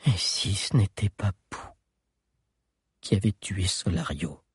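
hollow, hushed, like a ghost; sepulchral voice. Acted. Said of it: "d'une voix d'outre-tombe".

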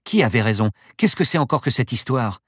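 alarmed passenger demanding an explanation; worried. A narrator's reading. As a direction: press for answers, rattled. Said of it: "s'inquiéta Paloma".

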